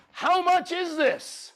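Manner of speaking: slow, high, drawn-out disbelief; stunned question. Retold or imitated. Asking wife the price.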